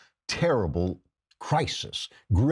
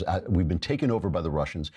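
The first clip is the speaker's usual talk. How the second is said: ordinary talk, nothing marked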